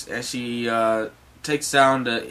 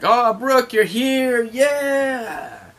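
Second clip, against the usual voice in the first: high, loud, drawn-out whoop; excited cheer; 11 semitones higher, 4 dB louder, much slower than usual. Acted. Crew greets Brook back on the ship, cheering. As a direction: cheer a returning friend at the top of your voice.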